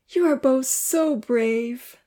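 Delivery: put-on shy little girl praising the boys; sweetly drawn-out admiration; sweet praise. A narrator's reading. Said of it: "Lily praised".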